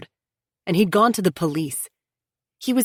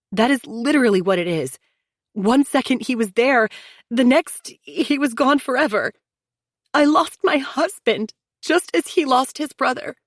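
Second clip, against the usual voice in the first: higher sister-in-law, the last lines slowed with loss; Chelsea, grieving; 9 semitones higher, 4 dB louder than usual. A narrator's reading. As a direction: plead through her own grief. Chelsea voiced, grieving her husband.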